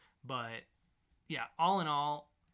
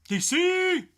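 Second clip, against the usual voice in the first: much higher, much louder, excited shout; hype shout; 15 dB louder than usual. Acted. Host hyping the next comic publisher, wild shout. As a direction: blast it like an announcer hyping the crowd. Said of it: "Is it all out of your system now?".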